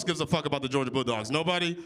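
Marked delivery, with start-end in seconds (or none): none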